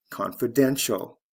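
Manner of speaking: "confidential" stressed on DEN; teacher's model of "confidential", beat on DEN; stress on third syllable. Shown as a demonstration. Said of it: "First syllable to third syllable".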